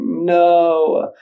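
high, loud, drawn-out no; mock dismayed refusal. Man resisting his rumbling stomach, mock wailing refusal. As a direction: wail it in exaggerated dread. Dreading a trip to the bathroom.

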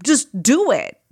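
high-pitched, pushy inner critic; pushy Ursula. Retold or imitated. Inner critic pushes podcaster to keep going, high and pushy. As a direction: goad with a mock pep talk. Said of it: "that voice in my head".

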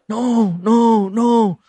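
hammed-up troubled movie hero; mock anguished distress. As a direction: play up the tormented refusal. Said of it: "mukanya gusar, mukanya sedih".

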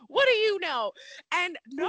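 high, loud indignant retort; very angry. Retold or imitated. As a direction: act out an angry comeback, pitch jumping up. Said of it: "I get very angry".

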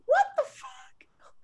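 slow whispered disbelief; whispered what the fuck, slowly; incredulous whisper. Retold or imitated. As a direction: hiss it slow and stunned. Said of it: "I'm like".

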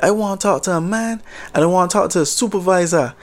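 put-on retelling a hot-headed Jamaican customer at the counter, in Jamaican accent. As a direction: imitate an angry Jamaican customer in a light Jamaican accent. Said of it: "maybe I'll just try the accent just a little bit".

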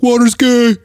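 high, loud jeering taunt; mock trash talk. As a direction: jeer it like a silly rival. Acted trash talk between military branches.

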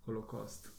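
"Holocaust" said low and soft, ending in KOST; trying out pronunciation. Shown as a demonstration. Podcaster practising the word after correction, trying out pronunciation. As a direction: murmur "Holocaust" with a plain o. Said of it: "du uttaler A-U-en".

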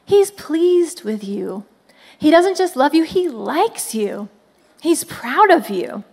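ordinary delivery

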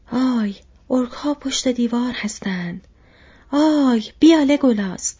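calling out, building to a high, loud shout; Gimli shouting. A narrator's reading. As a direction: shout a warning to a comrade, rising in force. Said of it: "فریاد زد".

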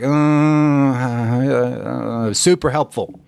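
stammering "Uh, uh, uh" before a half-hearted "super helpful"; mock hesitant. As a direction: drag out the "Uh, uh" as if unconvinced, then "super helpful". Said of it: "So if you're like".

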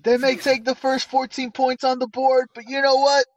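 rally the losing team at halftime; hammed-up pep talk; mock coach speech.

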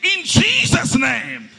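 high, full-force shouted prayer; loud, high preacher's declaration; screamed thanksgiving. Shown as a demonstration. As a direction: scream it out for the congregation to follow. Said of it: "scream and say".

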